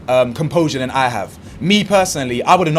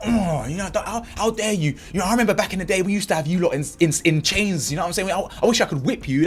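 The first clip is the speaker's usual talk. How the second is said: much softer muttered bigot voice; 4 semitones higher, 4 dB quieter than usual. Retold or imitated. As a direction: hiss the man's hidden thoughts under your breath.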